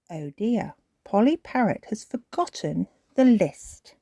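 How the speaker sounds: ordinary delivery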